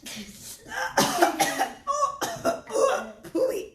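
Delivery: acted choking at a foul stench; sniff, then splutter "pooey" in mock disgust; hammed-up gagging at a stink; sniffing and coughing. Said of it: "he starts to sniff".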